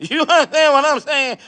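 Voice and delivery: a hint of Bernie Mac asking the crowd, fed up; Bernie Mac impression. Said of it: "in my Bernie Mac voice".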